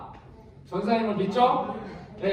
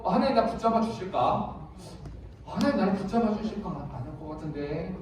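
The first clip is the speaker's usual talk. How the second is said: softer, lower doubting thought, trailing off; doubtful inner voice; 5 semitones lower, 7 dB quieter than usual. Acted. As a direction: voice a wavering believer's private doubt. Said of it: "라는 생각을 가지고 있으면". (의심하며 점점 작아지는 혼잣말)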